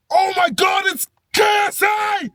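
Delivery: high excited squeal; inner starstruck scream. Retold or imitated. Starstruck fan screaming at meeting [name]. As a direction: fan screaming inside his head. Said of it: "That's what I'm saying in my head".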